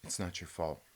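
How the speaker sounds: low, soft Good Will Hunting style reassurance; low hushed mock-tender comfort; mock therapist comfort.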